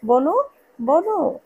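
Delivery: loud, slow drawn-out call; shouting a name. A narrator's reading. Brother calls out for his missing sister, loud and slow. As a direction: call out for the sister across the house. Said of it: "চেঁচিয়ে ডাকে".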